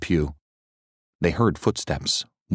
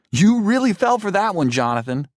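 high, loud teasing; mock him for falling for it; gleeful taunt; 14 semitones higher, 4 dB louder than usual. A narrator's reading. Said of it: "they said".

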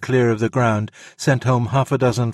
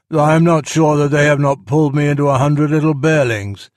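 loud, raised character voice; higher and louder, as a battered storybook bear; Baloo, grave and battered; 5 semitones higher, 6 dB louder than usual. A narrator's reading. Battered bear answers his friend after battle, gravely loud.

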